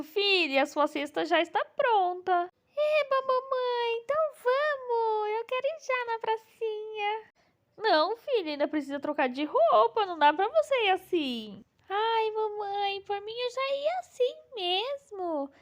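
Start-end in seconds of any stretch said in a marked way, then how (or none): 2.8-7.3 put-on little girl voice. Mom voices daughter doll Bela. 11.9-15.5 put-on high, slow, excited little girl. Bela doll pleads with mom.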